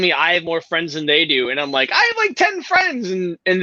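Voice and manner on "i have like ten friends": high-pitched mock defensiveness; squeaky indignant comeback, acted out; retold protest. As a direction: pipe up high, protesting your case. Retold protest about friend count.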